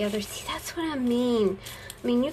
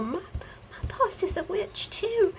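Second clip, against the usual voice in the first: higher put-on voice; mock whiny agreement; 10 semitones higher than usual. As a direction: keep up the squeaky complaint. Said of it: "And I would go".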